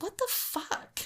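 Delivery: high, hushed, drawn-out incredulity; slow, airy, high-pitched mock shock; mock disbelief. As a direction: imitate a stunned reaction in a high half-whisper. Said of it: "Anybody else would have been like".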